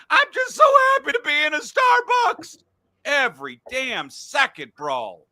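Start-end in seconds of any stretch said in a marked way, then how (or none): 0.1-2.6 very high, loud blubbering whine; mocking crybaby. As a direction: wail it like a weepy star in a coffee shop.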